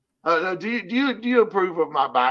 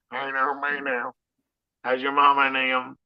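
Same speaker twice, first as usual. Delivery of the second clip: put-on Southern accent. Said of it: "he's got a Southern accent".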